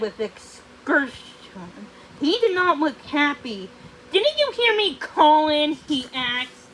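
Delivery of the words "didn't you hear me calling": raised, angry question; angry demand. Acted. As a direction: demand it loudly, annoyed. Angry sorcerer questions her.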